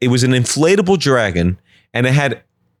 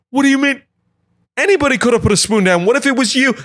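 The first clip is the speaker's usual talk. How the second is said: high, sweet husband voice, much higher; doting husband; 13 semitones higher, faster than usual. Acted. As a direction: gush like an oblivious husband admiring the yard. Husband voice in quarrel.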